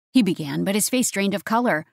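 ordinary delivery, nothing marked

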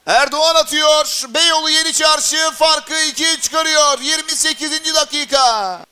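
long, stretched, loud goal cry; screaming football commentator. Real, not acted. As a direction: hold the goal call high and loud. Second goal, commentator erupts.

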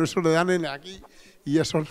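ordinary talk, nothing marked

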